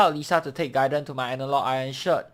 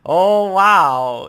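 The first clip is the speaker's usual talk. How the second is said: much higher and louder oh wow; surprised; 6 semitones higher, 14 dB louder than usual. Real, not acted. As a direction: caught off guard, loud and high. Player amazed at the joke.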